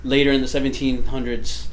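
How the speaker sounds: ordinary delivery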